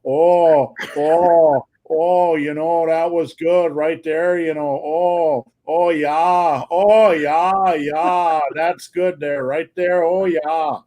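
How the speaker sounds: mock lovemaking in a broad Wisconsin accent. Imagining sex with a Wisconsinite, hammed-up Wisconsin accent. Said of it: "having sex with somebody like that".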